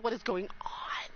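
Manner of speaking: soft, whispered bafflement; bewildered whisper. Real, not acted. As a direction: drop to a stunned whisper. Baffled by generosity.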